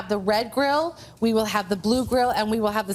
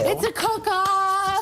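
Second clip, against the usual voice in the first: excited; 9 semitones higher, much slower than usual. Real, not acted. Host hypes the cook-off.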